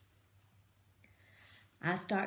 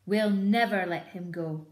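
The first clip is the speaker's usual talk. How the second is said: loud declaration, stress on never; declared; 8 dB louder than usual. Acted. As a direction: swear it out loud. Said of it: "Bunce declared".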